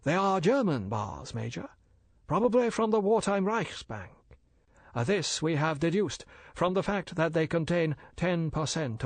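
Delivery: polite and bland, a dealer explaining; bland Chinese merchant. Acted. Older Fu brother lecturing the major, smooth and polite.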